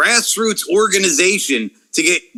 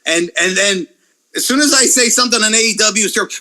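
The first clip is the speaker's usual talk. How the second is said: ordinary talk, nothing marked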